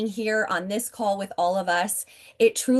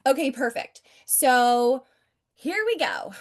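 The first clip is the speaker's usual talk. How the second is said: ordinary talk, nothing marked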